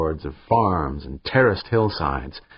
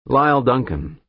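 put-on Highland accent; 5 semitones higher, 3 dB louder than usual. A narrator's reading. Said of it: "said the caller in a decidedly Highland accent".

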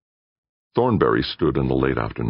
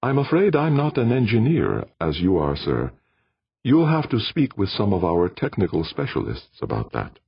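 wintry smile; 7 semitones higher than usual. Acted. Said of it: "With a wintry smile".

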